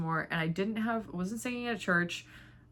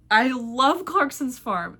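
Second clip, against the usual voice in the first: slow, loud, higher-pitched gush; 6 semitones higher, 8 dB louder, much slower than usual. Real, not acted. Telling viewers about a favourite show, gushing. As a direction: lean long and hard into the love.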